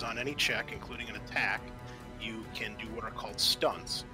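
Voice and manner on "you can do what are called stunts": normal talking voice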